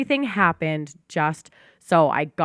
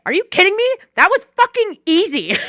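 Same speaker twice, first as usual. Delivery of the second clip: high, loud amazed exclamation; hammed-up shock at how easy it was; mock disbelief; 14 semitones higher, 8 dB louder than usual. Scolding herself after the easy amends, loud mock disbelief. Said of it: "I'm like".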